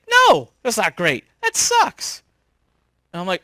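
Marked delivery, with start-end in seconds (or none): 0.1-2.2 blunt. Retold or imitated. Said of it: "And he's like".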